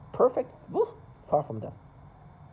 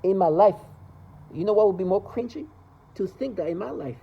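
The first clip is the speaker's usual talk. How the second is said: normal talking voice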